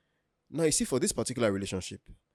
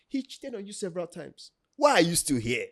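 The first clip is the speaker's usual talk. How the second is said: exasperated questioning; 7 semitones higher than usual. Retold or imitated. Boyfriend questioning girlfriend about her ex, mock exasperated. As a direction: act out baffled exasperation.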